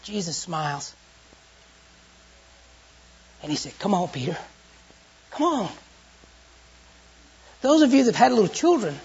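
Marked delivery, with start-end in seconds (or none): 3.8-5.7 gentle invitation. Acted. Jesus gently invites Peter.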